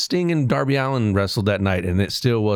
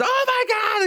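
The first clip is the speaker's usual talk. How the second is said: starstruck squeal. Retold or imitated. Fans freak out at Rock.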